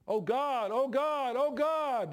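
rising, frantic pleading to God; mock panicked cries. Atheist in a foxhole crying out in panic.

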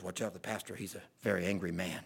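very low, hushed aside; imitated confidential warning. Seth warns arriving churchgoers about the pastor, hushed. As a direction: drop right down low and soft, as a warning.

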